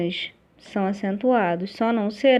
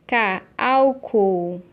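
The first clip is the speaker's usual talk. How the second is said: each syllable drawn out slowly, as if dictating; slow dictation; much slower than usual. Shown as a demonstration. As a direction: read the word slowly for the class to note. Teacher reads exercise word aloud. (leitura lenta e pausada da palavra)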